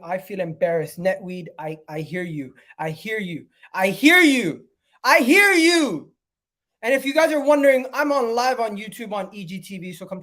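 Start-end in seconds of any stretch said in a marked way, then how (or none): 3.7-6.1 louder, higher, drawn out; emphatic agreement. Real, not acted. Streamer loudly agreeing with a viewer in chat. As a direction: lean hard into each word.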